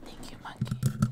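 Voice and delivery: slow whisper into the mic; soft, unvoiced and drawn out; whispered thanks. Real, not acted. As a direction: breathe a playful, hushed thank-you.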